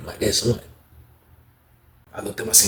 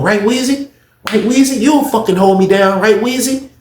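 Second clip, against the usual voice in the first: much higher, louder begging for agreement; high pleading mock; 11 semitones higher, 4 dB louder than usual. Guard asking inmate for loyalty, high pleading mock.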